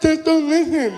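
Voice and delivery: high, insistent imitation of deaf speech; deaf fan. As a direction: urge the comedian on as the deaf fan. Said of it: "he was partially deaf".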